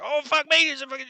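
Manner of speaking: high, loud panicked goblin yelp; startled goblin. Acted. Goblin peeking round the corner spots the party, loud startled yelp. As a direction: squeal it out like a goblin caught off guard. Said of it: "He's like".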